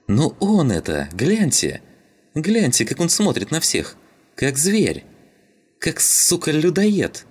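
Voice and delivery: high, agitated exclamations; excited accusation. Acted. Шум tells the others the sailor looks guilty, agitated. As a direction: point him out to everyone, worked up.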